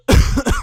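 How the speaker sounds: sharp cough. Real, not acted. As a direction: cough into the mic. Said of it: "more coughing into the microphone".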